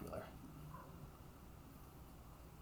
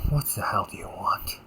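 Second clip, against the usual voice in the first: loud, harsh stage whisper; angry whisper; 8 dB louder than usual. Acted. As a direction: hiss the question at your sibling. Drops act, confronts sibling.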